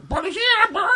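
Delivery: very high parrot alarm call; parrot squawk. Retold or imitated. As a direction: squeal it like a parrot, half laughing. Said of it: "porque la cotorra".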